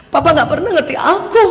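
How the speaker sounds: hammed-up wounded outburst; soap-opera wife. Wife finally answering her husband, soap-opera melodrama. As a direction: wail it like a melodrama heroine. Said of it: "Kayak sinetron ya".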